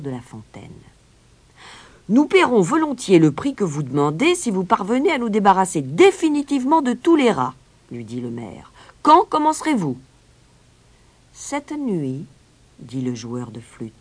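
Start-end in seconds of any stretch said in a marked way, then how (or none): 2.1-7.5 loud, high, projected official pledge; promising mayor. A narrator's reading. Mayor feigns acceptance to the piper, loudly promising. 9.0-10.0 high, loud, eager question; pressing mayor. A narrator's reading. Mayor asks the piper when he starts, high and pressing. 11.3-12.3 high-pitched piper's voice; composed piper. A narrator's reading. Piper answers the mayor, high and composed.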